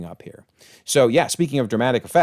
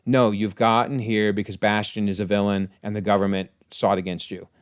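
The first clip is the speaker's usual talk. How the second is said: ordinary talk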